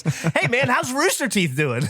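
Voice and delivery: high-pitched imitation of a chirpy fan; mock-cheery fan greeting; eager fan. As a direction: greet them brightly in a put-on high voice. Voicing an over-eager fan's greeting.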